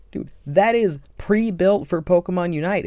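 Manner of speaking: real gamer excitement, voice jumping up; excited. Real, not acted. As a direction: sell how perfect the fit is. Hyped about move design.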